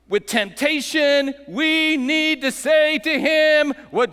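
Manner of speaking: loud, high, building preaching; preaching at full force. Real, not acted. Pastor rallying the church against temptation, fervent preaching. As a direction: build it to a climax.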